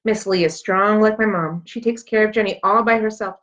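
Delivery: usual speaking voice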